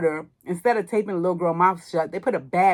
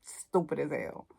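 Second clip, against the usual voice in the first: much softer, a dismissive aside under the breath; muttered verdict; 3 semitones lower, 10 dB quieter than usual. Retold or imitated. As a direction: mutter it like a private put-down. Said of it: "I'm like".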